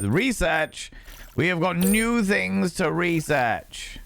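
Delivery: high, slow excited voice; thrilled about new research. Real, not acted.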